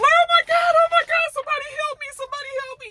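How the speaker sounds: very high, frantic screaming that fades out of breath; panicked cry for help. Retold or imitated. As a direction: scream for help over a dying boy. Jax finds Ozzy overdosed.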